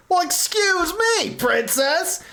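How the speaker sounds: high, slow bratty sneer; mock bratty princess voice. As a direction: sass it like a spoiled princess. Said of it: "so I could look absolutely bratty".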